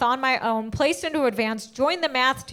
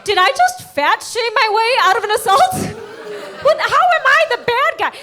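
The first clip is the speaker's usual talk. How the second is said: higher, louder, laughing through it; laughing disbelief; 9 semitones higher, 4 dB louder than usual. Real, not acted. Comic realising she fat-shamed her attacker, laughing. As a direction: crack up at your own absurd question.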